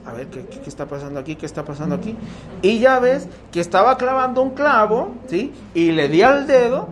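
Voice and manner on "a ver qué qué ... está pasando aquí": puzzled murmur. Retold or imitated. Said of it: "y dices".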